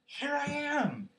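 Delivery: very high, loud, stretched squeal; piping, excited announcement; squeaky Roo. A narrator's reading. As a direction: sing out like a tiny child. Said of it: "squeaked Roo".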